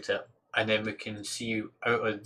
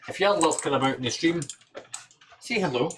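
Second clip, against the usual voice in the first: higher, louder call with a rising end; raised, higher hail with a rise at the end; 5 semitones higher, 5 dB louder than usual. Real, not acted. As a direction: call out to anyone lurking in the stream.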